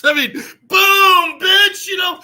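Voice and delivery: very high, loud, slow punchline yell; excited shout. Real, not acted. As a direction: belt out the punchline. Narrating the collapse.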